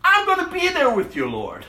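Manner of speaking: high, eager boast; Peter's bold promise. Retold or imitated. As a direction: swear loyalty a bit too brightly. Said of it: "He tells Jesus".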